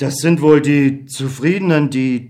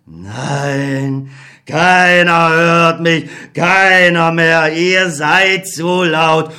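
sorrowful, can do nothing; helpless old God; 3 semitones higher, much slower than usual. Acted. God apologises to Beckmann, slow and grieving.

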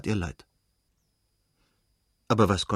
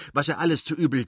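stumbling over words; 5 semitones higher, faster than usual. A narrator's reading. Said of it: "dass er sich verhaspelte".

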